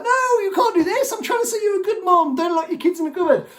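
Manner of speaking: high-pitched pleading impression; squeaky parody of the social worker; high mock voice. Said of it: "she's like".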